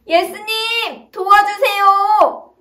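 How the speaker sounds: slow, high, tearful plea. Acted. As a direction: stretch it out, crying for help. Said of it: "더 큰 소리를 내볼까?".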